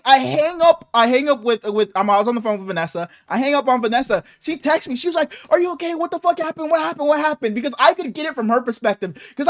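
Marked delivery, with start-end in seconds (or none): 5.5-7.5 higher-pitched imitation of an alarmed caller; worried partner. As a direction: lightly mimic her anxious rapid-fire questions. Voicing worried partner on phone.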